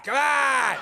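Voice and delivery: high, loud mock granny shout; hammed-up high rallying cry; rallying grandmother. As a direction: holler it to gee up the girls.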